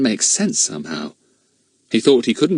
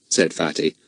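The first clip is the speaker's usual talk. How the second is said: plain reading voice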